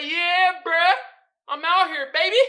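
high, loud cocky boast; swaggering kid on bike. Acted. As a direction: put on a hyped little thug. Voicing the boy in meme.

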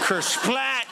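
mock splat noise; comic splat. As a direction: make the splat funny. Imitated splat sound.

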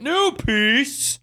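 high squeaky goofy announcement; silly high voice. Acted. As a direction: squeak it out like a joke. Joking chant of the phrase.

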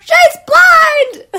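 very high, loud shout; screaming at the dog again; retold yell. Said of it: "yelling as I left the dog park".

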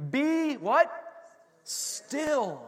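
Be what? asked high, then Still landed; call and response. Shown as a demonstration. Pastor asking the congregation to answer Still. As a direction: prompt the church to finish the verse. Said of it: "the Lord says specifically".

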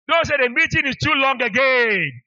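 loud, high, drawn-out warning to the crowd; emphatic callout. Real, not acted. Preacher teasing the congregation about long meetings, emphatic callout. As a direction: call it out big, stretching every word.

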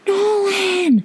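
long, very high scream of his name. Retold or imitated. Mother spotting her lost son, high scream. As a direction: shout his name across the beach in relief. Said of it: "And I scream".

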